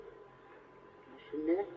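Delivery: ordinary delivery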